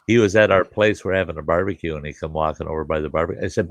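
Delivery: ordinary talk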